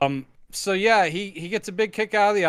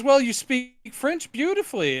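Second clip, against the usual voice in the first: gushing countess; 7 semitones higher than usual. Retold or imitated. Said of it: "And then she goes".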